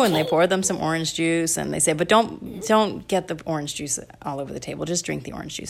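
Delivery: ordinary delivery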